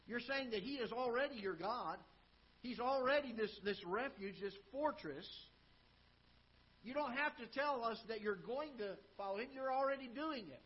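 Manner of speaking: fervent preaching. Acted. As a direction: press the point home to the congregation. Preacher addresses David directly.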